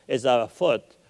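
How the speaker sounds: ordinary delivery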